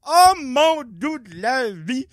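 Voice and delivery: slow, fed-up appeal to the heavens; stretched-out exasperation; exasperated groan. Real, not acted. Exasperated at clerk.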